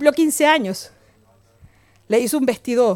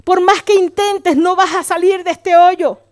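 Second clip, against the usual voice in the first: loud, raised taunt as the devil; accusing devil; 4 semitones higher, 6 dB louder, slower than usual. Acted. The devil tells a fallen believer they are useless, loud accusing taunt. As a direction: hammer each accusation slowly, higher and louder. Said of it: "el diablo dice".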